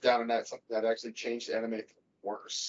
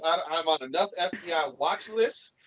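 usual speaking voice

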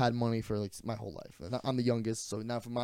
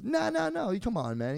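friend's mock high protest; 11 semitones higher than usual. Friend refusing, high pitched.

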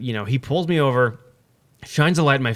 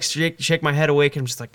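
usual speaking voice

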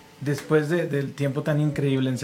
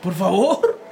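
peleador agobiado; 12 semitones higher than usual. Retold or imitated. Imita al peleador distraído.

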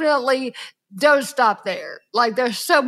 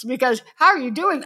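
usual speaking voice

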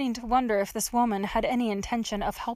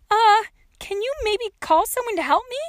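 put-on high, louder young girl's voice; tentative plea; 12 semitones higher, 6 dB louder than usual. A narrator's reading. Trapped girl asks old woman to fetch help, high pleading.

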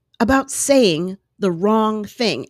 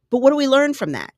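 ordinary talk

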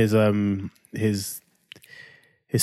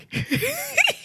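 high laugh; 3 dB quieter than usual. Real, not acted. Laughs at son's question.

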